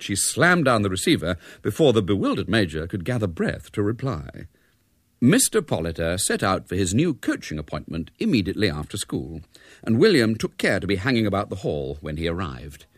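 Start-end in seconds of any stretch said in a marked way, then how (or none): none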